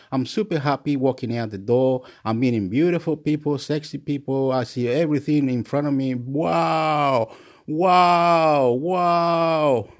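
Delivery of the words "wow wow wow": drawn-out wows of wonder; mildly awestruck wows; amazed. Acted. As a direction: marvel at everything in sight. Acting out amazement at everything.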